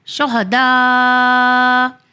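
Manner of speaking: "شہداء" ending on a very long open aa; hamza dropped when stopping. Shown as a demonstration. Teacher showing the pausing form, long open vowel. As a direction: stretch the last vowel of "شہداء", no hamza after. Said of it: "تو اس طرح پڑھیں گے".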